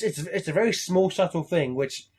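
ordinary talk, nothing marked

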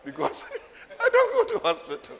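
amused, words breaking into laughter; laughing. Real, not acted. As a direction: tell it cracking up at yourself. Laughs at own hospital story.

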